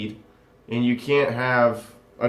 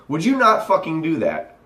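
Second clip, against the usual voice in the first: ordinary delivery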